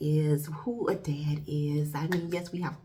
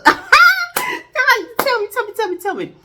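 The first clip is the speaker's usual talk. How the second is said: high laughing, slowly drawn-out tell me; laughing taunt; 13 dB louder, slower than usual. Acted. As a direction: giggle through the taunt, way up high. Teasing, laughing challenge.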